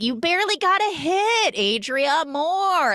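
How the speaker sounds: high, loud bossy urging; pushy party girl. Retold or imitated. The fat blunt queen forcing a hit, high and loud.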